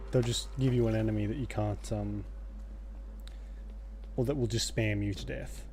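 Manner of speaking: normal talking voice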